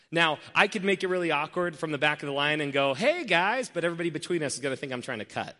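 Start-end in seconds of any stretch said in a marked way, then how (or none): 2.9-3.7 calling out. Retold or imitated. Calling out across coffee line.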